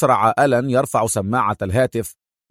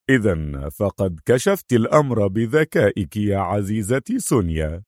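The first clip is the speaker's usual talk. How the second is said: mildly mocking compliment; a touch of dry sarcasm; sarcastic; 3 semitones lower than usual. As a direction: needle her with understated scorn. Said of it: "ساخر ميزته سونيا على الفور".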